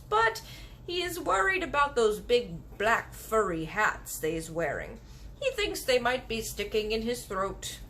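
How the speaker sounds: the kindly giant sharing gossip. Acted. Giant telling the girl a secret, gentle. BFG voiced in story.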